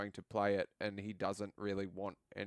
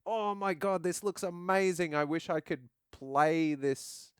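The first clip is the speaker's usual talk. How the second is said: high-pitched mock amazement; acted-out starstruck wonder; awed excitement; 7 semitones higher than usual. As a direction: gush like an impressed onlooker, drawing out the wish. Acted reaction to stunning cutscenes.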